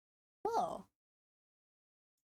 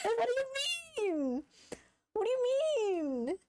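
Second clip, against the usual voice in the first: laughing; 12 semitones higher than usual. Real, not acted. Laughing repeated question.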